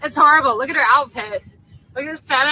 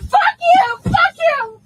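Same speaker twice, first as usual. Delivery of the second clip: loud, high, tearful shriek; furious screaming; 11 semitones higher, 5 dB louder, slower than usual. Real, not acted. Passenger told to calm down explodes, furious screaming. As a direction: scream it in real rage.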